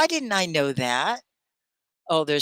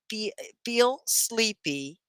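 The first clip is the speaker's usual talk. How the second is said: slow, higher teacher's demonstration; slow model phrase; 6 semitones higher, slower than usual. Shown as a demonstration. Teacher showing Tony how to say it, slow model phrase. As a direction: say it slowly for a learner to copy. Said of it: "You say it like this".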